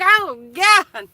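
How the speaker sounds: high, loud "Oh, God"; sudden loud cry of dismay; exclaiming. Retold or imitated. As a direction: blurt it out in shock. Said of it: "I'm like".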